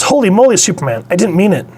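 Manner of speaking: high, hurried mock plea; acted panicked apology. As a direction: exclaim like a startled comic-book kid. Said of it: "he pauses because he goes".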